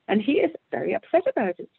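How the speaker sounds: usual speaking voice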